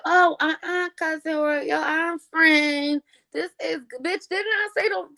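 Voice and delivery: high, mocking voice of a nosy relative; high mock of a moocher; mocking gossip. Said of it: "bucket mouth bitches, always talking shit".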